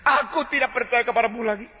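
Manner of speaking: angry believer rejecting God; defiant refusal. Acted. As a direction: throw faith back at God.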